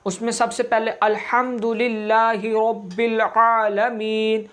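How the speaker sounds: ordinary talk, nothing marked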